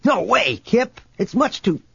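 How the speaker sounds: high-pitched, urgent warning; alarmed protest. Acted. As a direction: call out in alarm to stop him, cut off mid-sentence. Alarmed warning as Kip takes slope.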